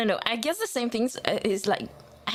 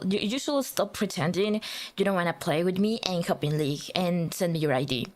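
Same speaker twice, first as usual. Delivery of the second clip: ordinary talk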